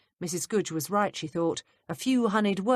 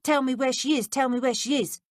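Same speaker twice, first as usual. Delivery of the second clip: pleading; 8 semitones higher, 5 dB louder than usual. Retold or imitated. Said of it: "Missus Trotter saying over and over".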